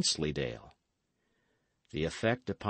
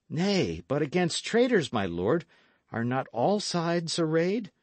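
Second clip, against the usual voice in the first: lightly acted protest, pitch lifted; a touch of exclamation, ending in a raised question; mildly crying out; 7 semitones higher than usual. A narrator's reading. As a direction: object eagerly to a lord. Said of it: "cried Dick".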